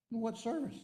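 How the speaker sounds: high-pitched retold disbelief; incredulous question. Baffled enlisted man quizzing the officer, incredulous. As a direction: question him as if you can't believe it.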